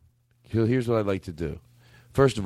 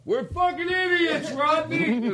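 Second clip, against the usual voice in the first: very high, drawn-out mock holler; band members in chorus; much slower than usual. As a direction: imitate a band owning up in squeaky unison. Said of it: "Seven people in the band get up".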